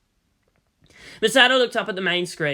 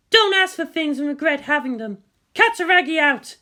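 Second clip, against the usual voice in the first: higher, sharp, combative officer; Misato's voice; 10 semitones higher than usual. A narrator's reading. Misato snapping at the general, higher and defiant. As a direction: push back hard, voice pitched up.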